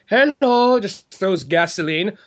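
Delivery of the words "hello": high, loud sing-out; fake friendly greeting. Landlord greets a boy in the dumpster, high and loud. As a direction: call it out with mock cheer. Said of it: "she's like".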